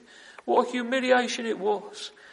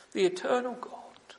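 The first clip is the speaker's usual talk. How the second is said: hushed whisper. Acted. Preacher to congregation, an awed whisper. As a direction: whisper it in awe.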